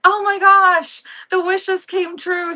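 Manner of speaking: high, gushing imitation of thrilled kids; mock kid squeal, slowing at the end; excited kids. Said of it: "the kids got all excited".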